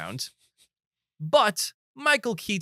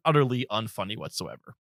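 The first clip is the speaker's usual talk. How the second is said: ordinary delivery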